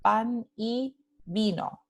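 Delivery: "pan y vino" slowly, each word separate; word-by-word example. Shown as a demonstration. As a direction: spell out "pan y vino" by ear, word after word. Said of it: "aquí está la transcripción fonémica". ("pan y vino" despacio, separando cada palabra)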